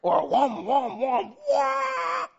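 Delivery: a revving engine, rising to a high shouted wail; growling engine rev climbing to a scream; engine revving noise. Pretend. Car engine power comparison.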